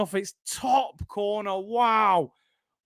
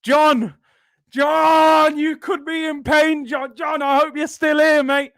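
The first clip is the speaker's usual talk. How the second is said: calling out; 6 semitones higher, 5 dB louder than usual. Real, not acted. Calling to chat member John.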